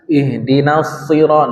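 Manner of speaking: Ihdinas shi- slowly, the shad thick; heavy shad. Shown as a demonstration. Teacher shows the thick shad to the class, slowly. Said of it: "na-nya biasa aja".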